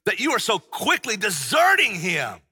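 Paul scolding deserting believers, loudly; impassioned shout. Shown as a demonstration. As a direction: shout it as a rebuke. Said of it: "if we could hear Paul say this".

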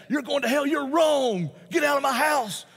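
high, loud, arrogant condemnation; arrogant shout. Pretend. Preacher mocking how he won't condemn a friend, loud arrogant shout. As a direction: bark it down at him like you own the truth. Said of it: "I don't do that arrogantly".

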